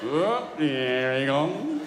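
very slow, drawn-out sarcastic wife; mock-weary wife impression; imitating wife. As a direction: drag the words out as the unimpressed wife. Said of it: "That was a bang-on impression".

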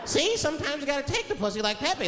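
high hammed-up cartoon voice. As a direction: ham up a cartoon narrator. Pepe Le Pew voice.

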